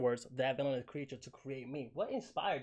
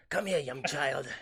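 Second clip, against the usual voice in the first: slow, eerie, louder villain beckoning; villainous recruiter voice; 5 dB louder, slower than usual. Retold or imitated. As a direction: lure the child in like a creepy witch. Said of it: "that's how she talks in my head".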